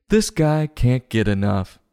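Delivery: ordinary delivery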